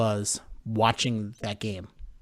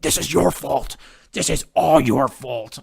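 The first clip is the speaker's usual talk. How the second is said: blaming his dad; 6 dB louder than usual. Retold or imitated. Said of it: "I'm like".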